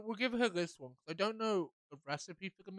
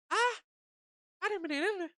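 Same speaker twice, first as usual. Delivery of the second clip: very high, loud squeaky character voice; high-pitched silly voice; 7 dB louder than usual. Acted. Streamer trying another voice for a character, high-pitched. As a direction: squeal it out in a put-on voice.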